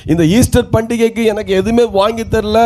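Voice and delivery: usual speaking voice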